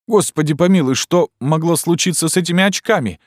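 horrified outcry at the spectacles; alarmed exclamation. Acted. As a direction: yell it out as the shock grows. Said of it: "воскликнул я".

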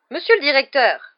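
high, loud shocked objection; protest, taken aback; spluttering protest. A narrator's reading. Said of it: "balbutia madame Pomme Fraîche". (protestation balbutiée)

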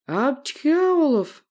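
high, loud sneeze into a name; explosive sneezing shout; sneezed-out shout. Acted. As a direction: blast the name out after a sneeze. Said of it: "бабахнул я здесь".

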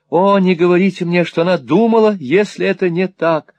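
high, urgent entreaty; impassioned pleading. A narrator's reading. Smike begs Newman not to deceive him, impassioned pleading.

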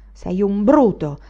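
slow, loud scornful accusation; bitter disdain, dragged out; contemptuous. A narrator's reading. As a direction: spit it out with contempt. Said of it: "gli disse con disprezzo".

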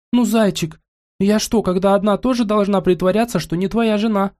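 high whiny pouty voice; sulky spoiled wife pleading; капризно. Acted. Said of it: "закапризничала девушка". (капризный надутый тон)

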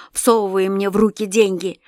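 ordinary delivery

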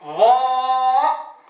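ضاء drawn out heavy, slow and sung; teacher chanting ضاء slowly for students; model letter name. Shown as a demonstration. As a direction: hold ضاء slowly with full emphasis. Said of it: "كيف ننطق به".

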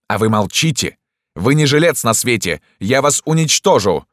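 high-pitched rising outburst; angry threat. A narrator's reading. Doctor threatening Dantes over the sheet, angry.

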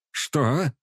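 sharp surprised question; startled, caught off guard; astonished. A narrator's reading. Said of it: "изумлённо поглядел на него патриарх".

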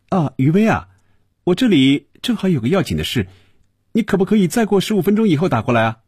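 high-pitched, hurried brush-off; reluctant reply. A narrator's reading. Tony asks the caller to ring back later, reluctant. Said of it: "不太情愿的回答".

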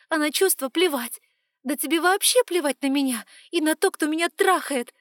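loud, high hurt accusation; tearful anger. Acted. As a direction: accuse him through rising tears. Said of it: "Слёзы вновь выступили".

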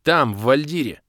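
indignant clarification; irritated. A narrator's reading. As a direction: correct her crossly. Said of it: "озлился я".

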